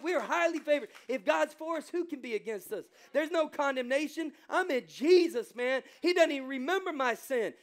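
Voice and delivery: loud, high, triumphant sermon shout; preacher declaring freedom from sin; full-force preaching. Acted. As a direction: lift the room with it. Preaching peak on favor.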